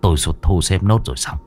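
put-on low, soft, grave old ritual master; an old master brushing the question aside. Old master speaks low and grave to the couple.

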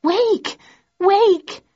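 high-pitched urgent cry; shrieked alarm in a woman's voice; shouting. A narrator's reading. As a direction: call out high to rouse a sleeper. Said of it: "as a voice shouting".

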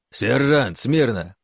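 loud barked command; barked order. A narrator's reading. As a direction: bark it like a drill sergeant. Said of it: "гаркнул кто-то".

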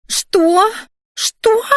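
high outraged squeal; shriek. A narrator's reading. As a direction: shriek it in disbelief. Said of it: "взвизгнула Криштия".

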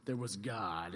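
low, slow epic movie-trailer voice; grand dramatic narrator voice; Star Wars opening. Retold or imitated. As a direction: intone it like a film's opening crawl. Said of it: "sounds like the beginning of Star Wars".

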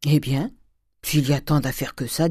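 a hint of a put-upon maidservant; lightly voiced grumbling servant. Nanon the servant balking at fancy coffee-making, lightly grumbled.

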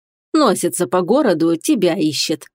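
plain reading voice

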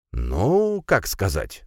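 musing, with a high drawn-out opening word; hedging pensively; thoughtful. A narrator's reading. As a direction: weigh the answer, starting high then settling. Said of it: "задумчиво произнёс Гудвин".

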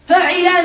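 فعل said fa-'i-la, middle vowel i; kasra on ain. Shown as a demonstration. Teacher listing verb patterns, فعل as fa'ila. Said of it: "فعل بكسر العين".

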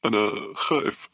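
put-on slow, high, frightened boy; trembling voice. A narrator's reading. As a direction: confess fear in a child's trembling voice. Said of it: "قال بصوت مرتعش".

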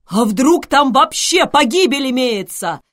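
loud, high-pitched alarmed outcry; crying out. A narrator's reading. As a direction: cry out at full voice. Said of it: "вскричал Зевс". (вскричать громко и высоко)

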